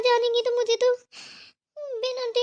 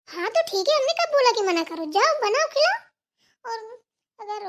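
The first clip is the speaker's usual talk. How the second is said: sarcastic retort; 3 semitones higher than usual. Acted. Daughter-in-law snaps sarcastically.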